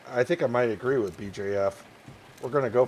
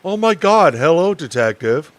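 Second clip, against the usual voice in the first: louder put-on woman's voice; 5 semitones higher, 9 dB louder than usual. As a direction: greet him in a character voice. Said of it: "Or maybe I can start, um, doing voices".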